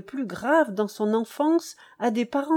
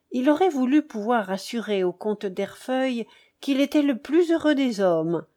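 ordinary delivery

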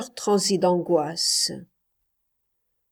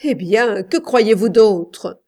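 louder, slow hard shout; wife shouting back, harsh and slow; brutal cry; 3 semitones higher, 9 dB louder than usual. A narrator's reading. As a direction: slam the question at him. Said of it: "cria-t-elle brutalement".

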